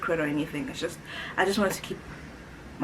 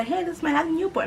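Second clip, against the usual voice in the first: high, breezy mock introduction; mock casual intro; 11 semitones higher, 3 dB louder, faster than usual. Mocking a flippant girlfriend introducing a boyfriend to mom, high and breezy. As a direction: toss it off like a careless girlfriend.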